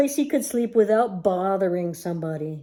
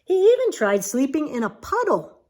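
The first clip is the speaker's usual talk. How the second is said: ordinary delivery, nothing marked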